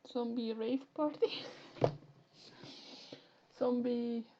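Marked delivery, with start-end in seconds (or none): none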